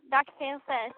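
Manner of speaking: ordinary talk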